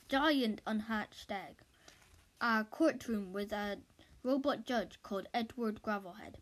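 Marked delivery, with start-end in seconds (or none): none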